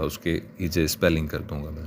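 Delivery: ordinary talk, nothing marked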